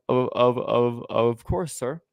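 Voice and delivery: slow, stammered "Of, of, of"; stutter. Retold or imitated. Agreeing to talk with the sheriff, stuttering. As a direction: trip repeatedly on "Of" before "course, sir". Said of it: "I did stutter whenever I talked to him".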